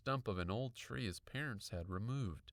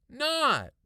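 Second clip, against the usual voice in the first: high, very loud one-word blast; angry scream; 14 dB louder than usual. A narrator's reading. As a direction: scream the refusal. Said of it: "I screamed".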